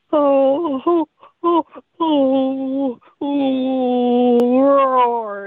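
high wailing oh sounds rising into a mock roar; imitating the creature's cry. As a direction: wail up high, then finish with a rough roar. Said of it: "I'll give you an idea".

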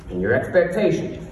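ordinary talk

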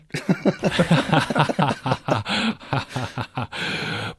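long amused laugh. Real, not acted. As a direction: laugh it off warmly. Long laugh after joke.

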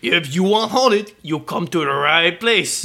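slow, drawn-out Cajun boat captain; Cajun accent. Retold or imitated. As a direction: Cajun drawl, slowed right down. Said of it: "my entire accent is built around that".

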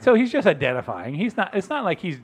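ordinary delivery

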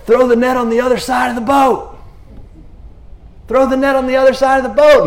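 high-pitched holler to a boat; sustained, imitated far-off yell; yelling over distance. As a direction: call it out across the water. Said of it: "kind of a good distance to yell".

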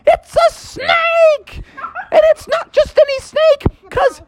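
very high acted shriek of alarm; panicked cousin. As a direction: scream the warning in a high girl-cousin voice. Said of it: "she was like".

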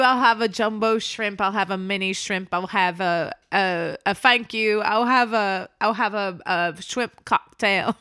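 mock British order. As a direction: order lunch in a fake British accent. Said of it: "watched her order a whole lunch".

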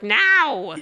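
retold sibling-style protest; imitated shared no. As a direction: reenact an exasperated, stretched no. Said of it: "the way that we both said no".

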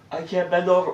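high, loud put-on complaint; mock injured claimant. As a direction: play up a fake injury. Acts out fake injury claimant.

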